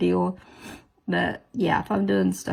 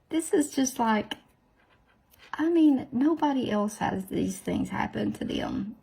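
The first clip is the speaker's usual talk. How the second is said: ordinary talk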